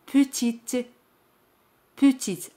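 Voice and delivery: petite with a crisp T at the end; sounded T. Shown as a demonstration. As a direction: say petite so the last consonant is heard. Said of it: "Aquí T se pronuncia".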